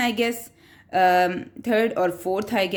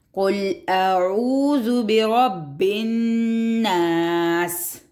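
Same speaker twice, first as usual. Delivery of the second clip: slow chanted recitation, doubled letters held; slow tajweed chant; Quran recitation; much slower than usual. Shown as a demonstration. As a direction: recite it slowly and stretch it. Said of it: "اس کی ادھر بھی شد ہے".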